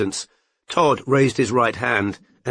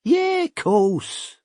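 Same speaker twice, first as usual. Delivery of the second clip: smug; 11 semitones higher than usual. A narrator's reading.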